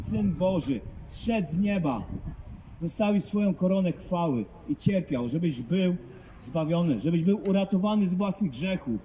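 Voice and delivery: ordinary talk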